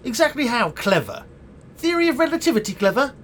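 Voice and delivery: high-pitched, mocking questions; scornful incredulity. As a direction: query the word with rising disbelief. Scornfully challenging friend's praise.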